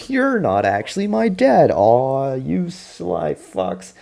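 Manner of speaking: high, loud, hammed-up shock sliding into a drawn-out groan; mock outraged realization. Daughter discovering he's not her dad, hammed-up loud outrage. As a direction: wail it high and loud, then stretch the ending.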